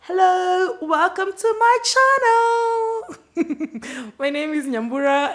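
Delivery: high, loud, stretched-out channel greeting; over-the-top host welcome; mock YouTuber intro. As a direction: belt it like a cheesy YouTuber opener. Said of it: "start officially and say".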